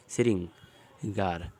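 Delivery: "श्रृंगार" spelled out slowly as "शि-रिं-गार"; slow syllable-by-syllable demo. Shown as a demonstration. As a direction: stretch out "श्रृंगार" for the students. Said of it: "इस तरीके से बनाएं". ("श्रृंगार" धीरे-धीरे अक्षर तोड़कर)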